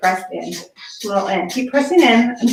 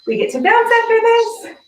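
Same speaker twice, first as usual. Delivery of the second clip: excited cheer; 12 semitones higher, 4 dB louder than usual. Real, not acted. Cheering class on after triceps.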